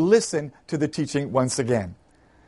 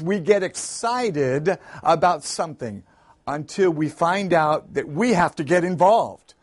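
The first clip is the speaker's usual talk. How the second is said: ordinary talk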